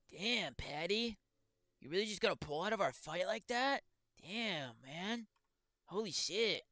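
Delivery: high, slow, drawn-out disbelief voice; MMA Guru impression. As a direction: stretch out Damn, Patty in a high whine. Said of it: "Guru's fucking impressions get stuck in my head".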